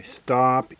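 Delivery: ordinary delivery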